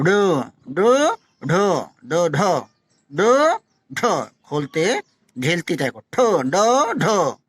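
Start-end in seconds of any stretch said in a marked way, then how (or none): 0.0-4.3 डो and ढो said slowly, tongue flicked off the palate; slow retroflex drill; retroflex syllables demo. Shown as a demonstration. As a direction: snap डो ढो open from the tongue tip. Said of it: "आप जो देखो".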